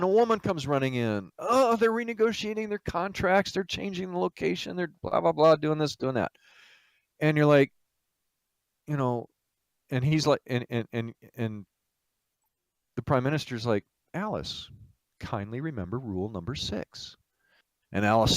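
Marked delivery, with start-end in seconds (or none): 1.4-6.3 frantic report. Acted. Second aide bursts in alarmed. 14.1-17.2 soft, measured gentle rebuke; calm reminder. Acted. Host calmly rebukes second aide.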